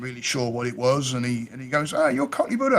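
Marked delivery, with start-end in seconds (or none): 1.9-2.8 high mock exclamation. Voicing mate naming him.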